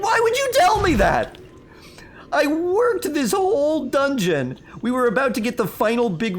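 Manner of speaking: over-the-top exasperated gamer whine; mock exasperation. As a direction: whine it like a gamer robbed of the big reveal. Exasperated at spoiled boss reveal.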